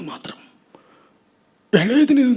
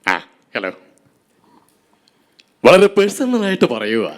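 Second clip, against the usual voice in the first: lightly voiced cop warning a bandit; measured police officer threat, a hint of Lalu Alex; Lalu Alex impression; 3 semitones lower than usual. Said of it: "ഏ, Lalu Alex". (Lalu അലക്സിന്റെ ശബ്ദത്തിൽ police താക്കീത്)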